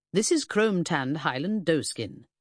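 usual speaking voice

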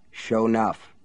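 ordinary delivery

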